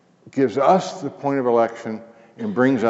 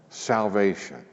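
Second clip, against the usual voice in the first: ordinary talk, nothing marked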